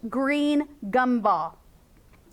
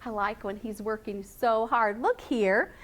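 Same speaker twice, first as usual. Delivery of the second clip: usual speaking voice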